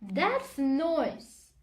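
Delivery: ordinary delivery, nothing marked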